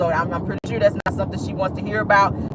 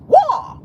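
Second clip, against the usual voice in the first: high, loud karate battle cry; Bruce Lee kiai; 5 dB louder than usual. Retold or imitated. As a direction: let out a kung fu yell, pitched way up. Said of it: "that's what Bruce Lee used to say".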